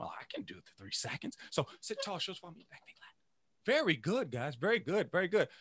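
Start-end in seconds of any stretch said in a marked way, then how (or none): 0.0-1.4 whisper. Retold or imitated. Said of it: "they go". 1.5-3.1 soft quick run of the cues; hushed drill. Shown as a demonstration. Teacher runs the cues for pupils, hushed.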